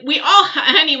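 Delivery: laughing. Real, not acted. Laughing at a viewer's comment while reassuring her. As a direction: laugh warmly while teasing.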